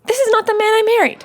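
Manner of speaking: half-laughing protest; laughing exasperation. Real, not acted. Wife laughing at her husband's new persona, exasperated.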